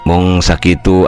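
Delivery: ordinary delivery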